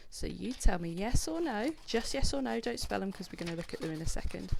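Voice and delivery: normal talking voice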